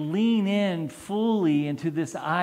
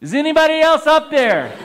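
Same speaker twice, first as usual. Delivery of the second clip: high, loud, fast shout with a laugh; punchline yelled in panic; panicked yell; 10 semitones higher, 9 dB louder, faster than usual. Retold or imitated. Man rejects the Lord's answer and yells for someone else, high and fast. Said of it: "he yells".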